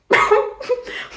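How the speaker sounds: airy giggle at own joke; high breathy laugh. Real, not acted. Teacher cracks up at their British bit, high airy laugh.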